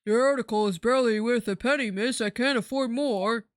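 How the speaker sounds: shopkeeper character. Acted. Restarts line in character's voice.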